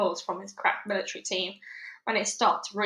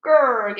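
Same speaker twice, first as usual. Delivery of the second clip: loud dog growl; 3 semitones higher, 10 dB louder than usual. Acted. Dog growls at the sneering major, loud growl. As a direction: growl like a bared-teeth dog. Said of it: "Yuri growled at the man".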